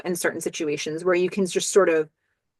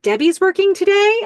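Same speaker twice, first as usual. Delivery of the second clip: much higher and louder, eager question; excited; 12 semitones higher, 7 dB louder than usual. Retold or imitated. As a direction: jump up with glee at the news. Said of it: "that gets the guys really excited".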